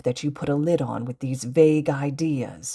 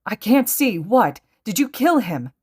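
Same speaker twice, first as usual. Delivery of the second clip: high, loud frantic questioning; panicked; 7 semitones higher, 5 dB louder than usual. A narrator's reading. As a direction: demand answers in alarm. Panicked captive in acted story.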